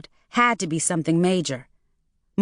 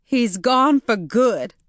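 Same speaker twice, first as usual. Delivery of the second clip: tearful; 5 semitones higher, slower than usual. A narrator's reading. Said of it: "choking on a sob".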